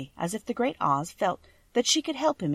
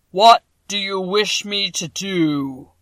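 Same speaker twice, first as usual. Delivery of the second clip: slow, booming, much louder giant head; a vast, slow, thundering wizard; voice of the great Oz; 10 dB louder, slower than usual. A narrator's reading. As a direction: boom each word out like a huge magic head.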